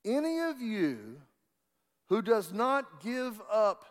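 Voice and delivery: high, slow, ringing pulpit delivery; preacher builds to the key line; preaching. Acted. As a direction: proclaim the scripture to the congregation. Preaching the verse.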